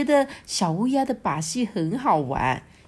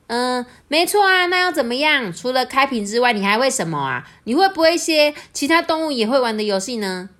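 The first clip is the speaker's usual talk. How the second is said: higher, louder cheeky crow challenge; 6 semitones higher, 4 dB louder than usual. A narrator's reading. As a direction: needle the peacock playfully. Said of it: "crow answers peacock". (调皮的小乌鸦)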